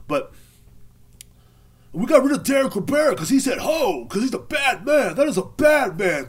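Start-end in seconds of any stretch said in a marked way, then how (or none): 1.9-6.3 high, loud, hammed-up crowing; mock celebration. Facebook crowd rid of Derek, loud mock celebration. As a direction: crow it like a gloating crowd.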